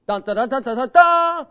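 sung trumpet call climbing to a high, loud final blast; full trumpet fanfare. Retold or imitated. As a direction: finish the fanfare with a triumphant high note. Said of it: "that trumpet's gonna go".

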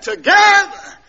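high, loud single-word cry; hollered pulpit text. Retold or imitated. Preacher finishing his repeated verse, hollering.

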